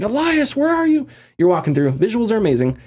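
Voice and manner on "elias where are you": high, urgent call for a lost partner. Retold or imitated. Jacob searching for Elias on the surface, calling out high. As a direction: shout out to find him, voice pitched way up. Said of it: "He's like".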